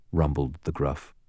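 plain reading voice

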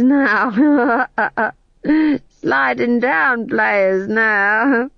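high, very slow tipsy drawl; drunk actor. Acted. Tom John introducing the players while slipping down, slow drunk. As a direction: slur it as you slide off the bench.

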